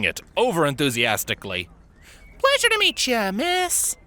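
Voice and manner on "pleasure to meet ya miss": friendly greeting. A narrator's reading. Earth Pony greets warmly.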